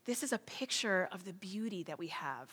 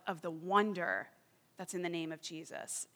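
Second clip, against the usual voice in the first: ordinary talk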